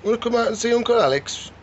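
high sing-song coaxing to a pet. Real, not acted. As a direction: pet voice calling a chameleon out to see Uncle Alex. Coaxing chameleon out, baby talk.

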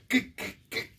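high stammered mock old-man voice; stuttering uncle. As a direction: sputter it out half annoyed, half amused. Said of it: "And he goes".

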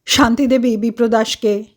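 plain reading voice

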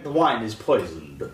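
lightly voiced bad Russian accent; low, quiet bad Russian accent. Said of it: "It's a bad Russian accent".

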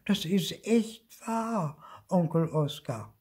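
high, slow, coaxing little voice; sweetly pleading. A narrator's reading. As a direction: coax your uncle as innocently as possible. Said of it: "Zipfel machte sein liebstes Gesicht".

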